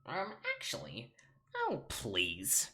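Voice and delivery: put-on nerd, leaping high in pitch. Shown as a demonstration. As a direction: correct them like a know-it-all nerd. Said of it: "Nerd voice".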